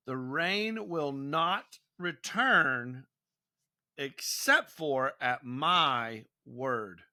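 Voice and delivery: slow, weighty prophetic pronouncement; solemn decree. Retold or imitated. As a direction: spell out the prophet's decree, slowly and deliberately. Prophet's decree quoted to the king.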